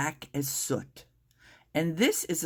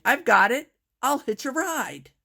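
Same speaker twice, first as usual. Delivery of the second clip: higher and louder, bursting with a bright idea; excited cry; 8 semitones higher, 5 dB louder than usual. A narrator's reading. Tiny snail cries out to the other snails, excited. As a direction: snail cries out her sudden plan. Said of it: "then cried".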